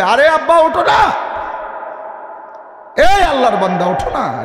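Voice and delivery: high, urgent wake-up call; rousing a sleeper. Acted. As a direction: call out pleadingly to get them up. Calling a sleeper to get up.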